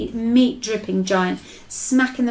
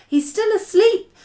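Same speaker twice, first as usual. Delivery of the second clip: raised, voiced high delivery; high-pitched; 12 semitones higher than usual. A narrator's reading. BFG watching the sleeping giant, high voiced take. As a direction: say it high, without whispering. First take, before whispered redo.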